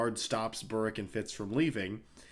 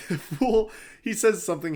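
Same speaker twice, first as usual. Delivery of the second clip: ordinary talk, nothing marked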